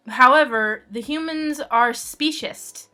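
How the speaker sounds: ordinary talk, nothing marked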